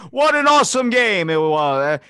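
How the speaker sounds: loud, high, chipper announcer; overly upbeat PA voice; cheesy announcer. Retold or imitated. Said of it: "the dude just comes out".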